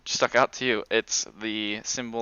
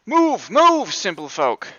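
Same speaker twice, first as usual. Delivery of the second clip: loud, high, drawn-out command; haughty rider's shout; calling out; 10 semitones higher, 12 dB louder, slower than usual. Acted. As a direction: bellow at peasants to clear the road. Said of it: "one of them calls out".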